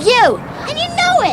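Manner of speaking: furious accusing outburst; angry accusation. Acted. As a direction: throw the blame at her.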